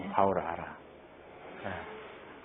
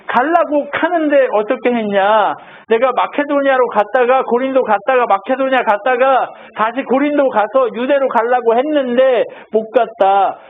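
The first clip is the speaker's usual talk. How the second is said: lifted, emphatic pulpit voice a bit higher; raised preaching; 5 semitones higher than usual. Acted. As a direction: preach it out to the congregation. Pastor preaching Paul's journey.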